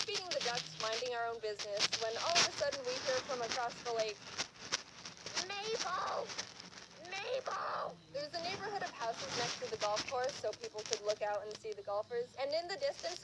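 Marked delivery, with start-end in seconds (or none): 5.5-7.9 high, drawn-out distant call; mock yell, pitched way up; lady yelling. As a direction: call a name across a lake. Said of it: "some lady was yelling".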